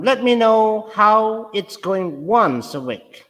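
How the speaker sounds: slow it down and mark the key word; stressed demo line. Shown as a demonstration. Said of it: "So stress is here".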